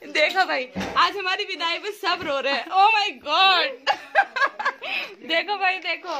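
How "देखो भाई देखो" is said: laughing. Real, not acted. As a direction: giggle and point him out. Laughing at boy crying. (हँसते हुए देखो कहना)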